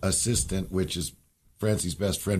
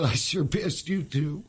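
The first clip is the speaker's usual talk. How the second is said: tearful; 7 semitones higher than usual. Real, not acted. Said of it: "I'm gonna cry".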